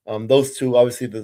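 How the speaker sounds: ordinary delivery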